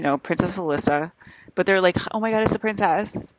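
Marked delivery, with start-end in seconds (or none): 2.1-3.3 higher-pitched imitation of starstruck excitement; excited. Aliens spotting the princess, mildly mimicked excitement. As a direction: lightly mimic a thrilled gasp of recognition. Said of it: "They, like, get so excited".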